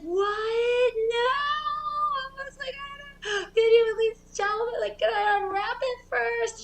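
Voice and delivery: put-on high, pleading child; whiny kid voice. Kid begging mom to let him unwrap the present first. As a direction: beg like a panicked eight-year-old.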